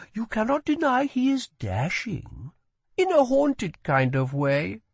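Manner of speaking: put-on a curious young woman asks; girl's voice. A narrator's reading. Girl defends prince.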